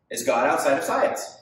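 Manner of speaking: usual speaking voice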